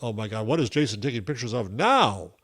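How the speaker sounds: ordinary talk, nothing marked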